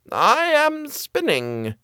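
very high, slow crone voice; old woman voice. A narrator's reading. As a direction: creak it out like an old spinner. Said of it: "said the old woman".

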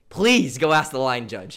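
loud, slow and insistent; a firm, drawn-out plea to the ref; pleading demand. Retold or imitated. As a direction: beg the ref to go ask, loudly. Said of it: "I'm like".

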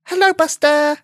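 high, loud friendly call. Acted. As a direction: call out across the yard. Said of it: "called Buffy".

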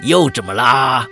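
understated impatience; impatient. A narrator's reading. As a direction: show you are fed up. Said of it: "关瑞兴不耐烦".

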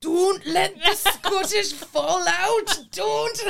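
high, slow, strained inner plea; strained accent-concealing actor. Retold or imitated. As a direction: play an actor desperately holding his accent in, loud and drawn out. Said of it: "he's trying to, like, just".

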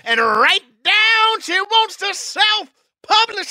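high, rising preacher call. Pretend. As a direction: preach it up to the rafters. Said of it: "All right, Reverend".

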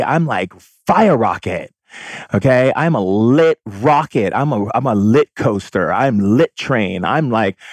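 over-the-top energized boast; hyped-up rant. Retold or imitated. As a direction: sell how fired up you are. Answering people, hyped reply.

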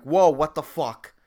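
loud, drawn-out startled "whoa"; startled reaction. Retold or imitated. Retelling waking up confused at Sam's, startled. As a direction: retell a shocked reaction at full voice.

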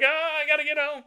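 a hurried, fussing excuse; mock urgent. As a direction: friend rushing home before sunset. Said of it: "He's like".

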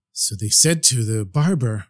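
plain reading voice

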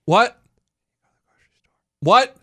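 normal talking voice

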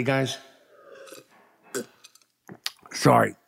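talking around crunching ice; words through a mouthful of ice; chewing while talking. Real, not acted. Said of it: "I'm chewing ice".

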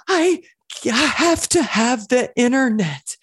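high-pitched, drawn-out mock meltdown; retold freak-out. Host retells freaking out to her husband over no internet, mock meltdown. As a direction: wail it word by word, hammed up. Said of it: "I was freaking out".

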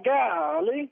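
usual speaking voice

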